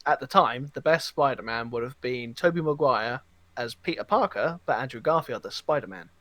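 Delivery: usual speaking voice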